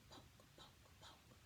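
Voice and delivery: softly whispered sound words; whispered poke. A narrator's reading. Storyteller whispering the bear's pokes. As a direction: breathe each poke. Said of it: "poked Joe's tummy".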